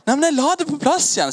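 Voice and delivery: put-on pleading boy. Boy answers the shopkeeper, high desperate plea. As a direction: plead that you put it back.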